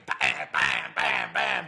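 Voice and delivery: low, punchy bam noises; banging sounds imitated. Hammering the safe at the motel, low imitated bangs. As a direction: thump out each bam low. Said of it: "We're banging".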